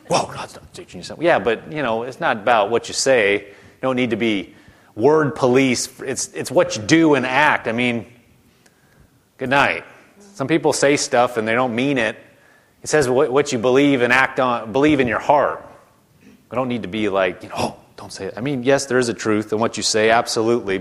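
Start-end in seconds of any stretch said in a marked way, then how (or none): 0.1-1.2 whispered, fast nitpicky correction; mock whispered correction. Mocking corrector. 17.6-18.3 mock hushed scolding. Mock word police.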